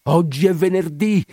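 high, loud exclamation; crying out. A narrator's reading. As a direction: keep shouting at the holy image. He goes on crying to the image. (grido forte e acuto)

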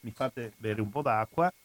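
normal talking voice

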